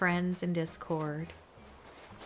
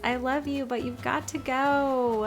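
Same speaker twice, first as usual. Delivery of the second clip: fond farewell; 7 semitones higher, 4 dB louder than usual. Real, not acted. Affectionate farewell to villager.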